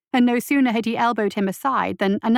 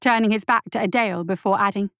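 plain reading voice

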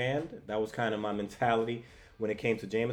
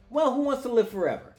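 cocky hero quip; 10 semitones higher, 4 dB louder than usual. Retold or imitated. Spidey's cocky quip in retold comic.

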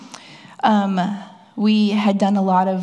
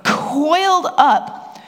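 mock fearful husband; 6 semitones higher, much slower than usual. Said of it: "he goes".

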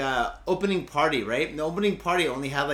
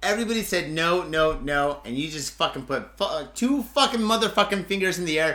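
usual speaking voice

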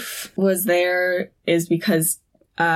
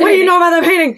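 high, loud interrogation; super intense; 9 semitones higher, 6 dB louder, much faster than usual. Retold or imitated. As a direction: demand an answer, louder and higher. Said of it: "In, like, super intense way".

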